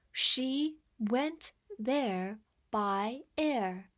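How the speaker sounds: very slow, drawn-out model sentence; slow reading. Shown as a demonstration. Teacher reads slowly so the class can repeat, very slow. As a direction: stretch every word for learners. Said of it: "我们慢慢来读".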